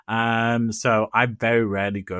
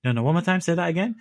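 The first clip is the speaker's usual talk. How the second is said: mock English customer; 9 semitones higher, 4 dB louder, faster than usual. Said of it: "She goes".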